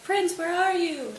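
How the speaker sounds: high, drawn-out call. Retold or imitated. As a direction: call out as if searching for a friend. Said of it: "they're calling out for him".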